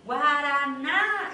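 slow, loud call with a long lifted NÁ; calling out word. Shown as a demonstration. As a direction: holler GUARANÁ as if at the gate. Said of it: "Olha aí o que que eu fiz".

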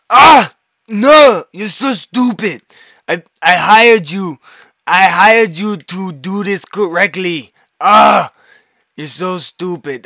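imitating an Austrian accent in a high, loud angry rant; angry Austrian boss. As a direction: yell like an uptight Austrian boss scolding a worker. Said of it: "he'd be".

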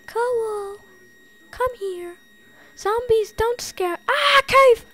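high squeaky call building to a yelp. Pretend. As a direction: call it high like coaxing a pet. High calling, then cave scream.

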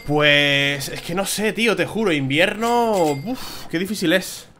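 exasperated whine. Real, not acted. Gamer moaning to viewers about the hard winter, whiny. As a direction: drag out invierno in despair.

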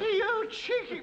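high, drawn-out outraged cry; indignant outburst. Acted. Patient outraged at his friend, high slow indignant cry. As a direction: splutter with indignation.

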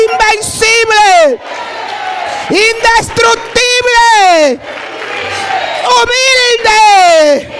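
building to high, loud, drawn-out shouts; full-force preaching. Real, not acted. Preacher leading the congregation to repeat after her, shouted. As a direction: proclaim each quality at full cry.

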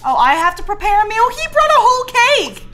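high, loud disbelief; shocked outburst. Real, not acted. Streamer shocked by the cake and the dancing, yelling. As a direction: yell in disbelief.

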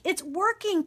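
excited cry. A narrator's reading. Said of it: "Pippa cried".

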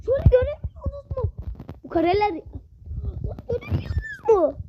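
indignant high whine. Real, not acted.

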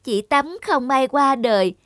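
ordinary delivery

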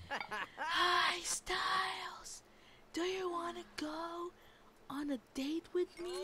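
high, breathy pretend whisper with a laugh; mock whisper. A player imitating her Sim whispering to Styles, mock whisper. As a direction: act out a whispered date invitation, slowed down. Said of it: "it's silent yelling".

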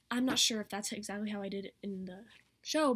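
ordinary talk, nothing marked

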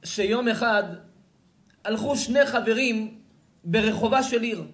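loud, high declamation of scripture; Midrash recitation. A narrator's reading. Preacher reads the Midrash to his audience, declaiming loudly.

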